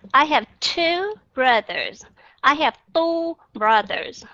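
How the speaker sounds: "two" said slowly with an aspirated English t; slow demo. Shown as a demonstration. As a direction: spell out "I have two brothers" slowly. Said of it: "rất là rõ ràng cái âm T".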